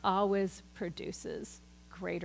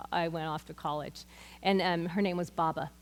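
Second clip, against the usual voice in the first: normal talking voice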